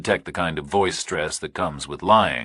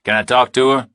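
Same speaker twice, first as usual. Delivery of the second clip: louder, higher, tense; tense Burke; 7 semitones higher, 8 dB louder than usual. A narrator's reading. Burke answering the kidnapper, tense and louder. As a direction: push through nerves.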